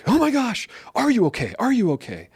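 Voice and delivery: put-on a parent freaking out over a fallen kid; panicked parent. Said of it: "the parents freak out".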